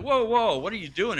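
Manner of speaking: a mock shocked whoa; startled disciples. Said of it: "They're like".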